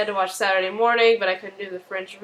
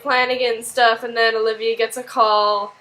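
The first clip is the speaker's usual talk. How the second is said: usual speaking voice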